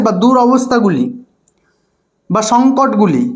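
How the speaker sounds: usual speaking voice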